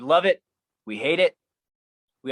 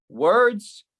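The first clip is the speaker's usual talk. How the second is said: "Words" pushed higher and louder; louder, higher echo; 6 semitones higher, 5 dB louder than usual. Acted. Poet throwing the word back, louder. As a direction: hit "Words" hard on the repeat. Echoed word, second take.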